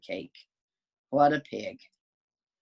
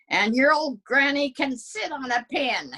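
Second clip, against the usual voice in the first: higher pig voice jeering about granny; a cheeky piglet taunting the wolf; rude pig taunt; 11 semitones higher than usual. Acted. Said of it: "the third little pig yelled".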